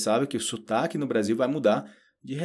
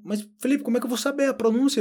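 student's objection; 13 semitones higher, 3 dB louder, faster than usual. Retold or imitated.

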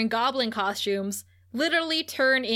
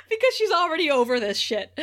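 higher pitch, breaking up with amusement; laughing; 7 semitones higher, 3 dB louder than usual. Real, not acted. Podcaster cracking up over Willow's retort, laughing. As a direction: talk through real laughter.

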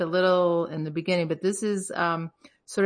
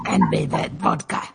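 much lower, heavy Russian growl; imitating the song's vodka line, lower, with a Russian twist; deep mock Russian. Repeating the song's vodka line to friends, deep Russian growl. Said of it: "So I just kept playing".

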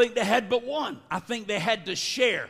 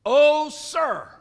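mock anguished cry, raised in pitch and volume; 4 semitones higher, 5 dB louder than usual. Son of the prophets who lost the borrowed axe, crying out. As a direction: cry it out in retold despair. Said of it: "he cried".

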